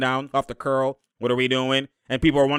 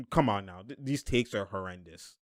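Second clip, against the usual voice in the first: ordinary talk, nothing marked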